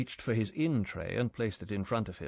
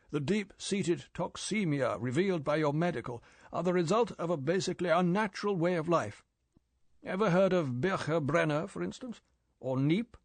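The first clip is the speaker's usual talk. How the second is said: put-on severe old chief lecturing, higher; raised, lecturing boss voice; 7 semitones higher than usual. M listing naturopaths.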